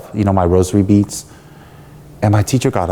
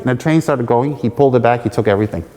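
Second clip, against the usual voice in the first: ordinary talk, nothing marked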